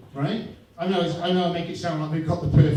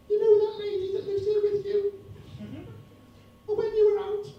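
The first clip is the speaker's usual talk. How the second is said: much higher tiny cat voice; cat's voice. Retold or imitated. Cat telling the comic about his wife, high squeaky voice. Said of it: "the cat is actually saying to me".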